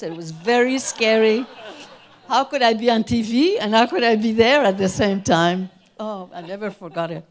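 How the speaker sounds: loud, high laughing retelling; telling a funny story and laughing. Real, not acted. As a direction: laugh through the funny memory. Laughing retelling of being mistaken.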